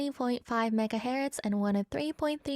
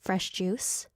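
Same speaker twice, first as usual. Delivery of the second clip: usual speaking voice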